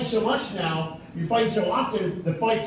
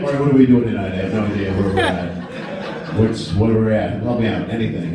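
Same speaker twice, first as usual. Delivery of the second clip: clueless husband; 11 semitones lower than usual. Retold or imitated. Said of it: "I'd be like".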